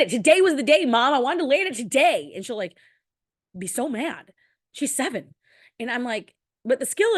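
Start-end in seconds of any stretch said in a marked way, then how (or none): 0.1-2.3 put-on higher, louder, whining child; mock upset child pleading with mom; frustrated kid's cry. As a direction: act out a seven-year-old's fury at missing the skill. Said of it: "She's like".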